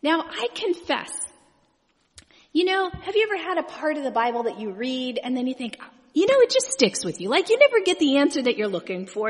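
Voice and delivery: normal talking voice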